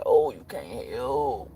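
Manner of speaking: drawn-out mocking "Oh, you can't help."; slow sarcastic retort. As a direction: stretch out "Oh, you can't help." slowly and with scorn. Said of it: "I might not have said it like that, but that's how the fuck I said it".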